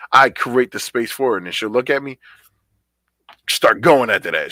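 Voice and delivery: normal talking voice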